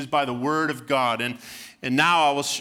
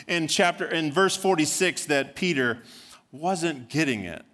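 ordinary delivery

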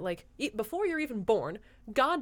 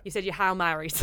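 mild Boston accent on Hail Marys, a little louder; 6 dB louder than usual. Shown as a demonstration. A friend tries Boston vowels on Hail Marys, lightly. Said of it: "that sounds a little bit like a Kennedy".

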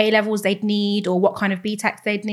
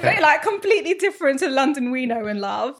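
laughing; 6 semitones higher than usual. Real, not acted. Laughing about Canadian London.

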